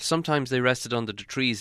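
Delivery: usual speaking voice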